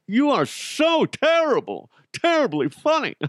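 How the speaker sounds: very high, gushing mock laughter and praise; hammed-up admirer. Fan flattering a radio host's stale joke, hammed-up. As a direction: fake fawning audience member, squeaky-high.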